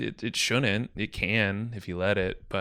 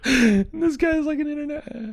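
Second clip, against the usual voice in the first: high squealing laugh through the words; can barely talk for laughing; laughing hard; 5 dB louder than usual. Real, not acted. Laughing at the memory.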